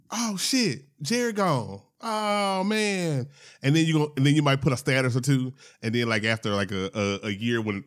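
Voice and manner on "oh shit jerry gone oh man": higher, mildly mournful imagined reaction; understated mock dismay. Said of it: "It's gonna be like".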